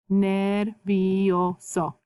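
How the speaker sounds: Nervioso stretched syllable by syllable, ner-vi-o-so; sounded out slowly. Shown as a demonstration. Teacher sounds out the word syllable by syllable. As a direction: sound out every vowel of Nervioso. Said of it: "remember how to pronounce your vowels".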